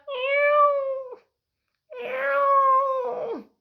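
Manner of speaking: a disdainful little mew; the cat answers the duck; cat meow. Acted. Cat meows in story.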